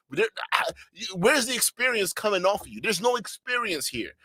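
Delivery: ordinary delivery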